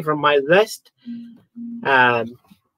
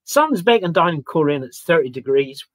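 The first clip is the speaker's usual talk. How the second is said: usual speaking voice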